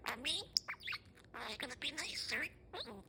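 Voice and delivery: normal talking voice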